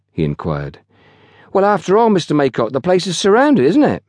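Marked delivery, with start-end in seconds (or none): 1.5-4.0 put-on high-pitched young porter explaining; high country lad, stating the obvious. A narrator's reading. Young porter explains the situation to his boss, confident.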